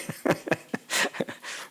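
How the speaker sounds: soft breathy chuckle; low-key amused laugh. Real, not acted. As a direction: let out a quiet run of chuckles. Chuckles after quoting the villagers' approval.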